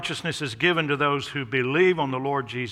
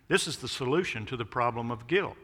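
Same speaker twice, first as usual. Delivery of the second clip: normal talking voice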